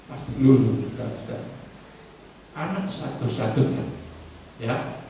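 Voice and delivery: normal talking voice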